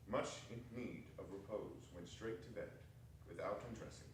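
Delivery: fading to sleep. A narrator's reading. Narrator lulls Scrooge to sleep.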